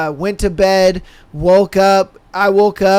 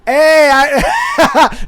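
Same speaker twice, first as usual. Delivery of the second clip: high, loud laughing call-out; laughing shout; 7 semitones higher, 4 dB louder than usual. Real, not acted. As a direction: burst out laughing at the comment. Laughing outburst at comment.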